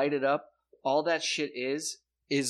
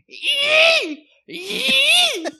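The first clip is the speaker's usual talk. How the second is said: high, loud shrieking animal cry; creature screech imitation; 12 dB louder than usual. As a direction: screech like the thing in the woods. Imitating the night creature's call.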